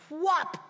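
loud, high, sudden "whop"; explosive one-syllable strike sound; imitated cat swipe. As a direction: punch out a sharp swat noise. Imitates cat swiping at snake.

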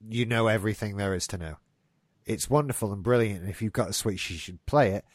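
normal talking voice

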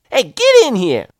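loud high Southern woman yelling; calling the dogs. Retold or imitated. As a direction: holler for the dogs to come in. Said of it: "she was calling her dogs".

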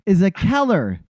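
baffled outrage. Retold or imitated. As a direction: stretch it out like you're staring at a lunatic. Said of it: "just looking at this woman like".

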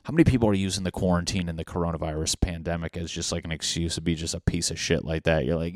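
ordinary talk

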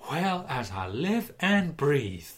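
a laughing Southern gent, slowly drawn out; Southern drawl. Retold or imitated. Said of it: "Yeah, Brixton accent".

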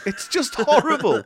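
high, drawn-out disgust through laughter; laughing, exasperated revulsion; appalled. Real, not acted. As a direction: wail it in amused horror. Ranting about grotty cards, laughing.

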